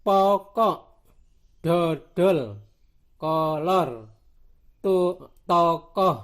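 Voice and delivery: loud, separated words with open O in pokok, dodol, kolor, tokoh; pokok, dodol, kolor, tokoh with a wide open O; open O demonstration. Shown as a demonstration. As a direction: model the open O of pokok, word by word. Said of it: "Kemudian yang dibaca O".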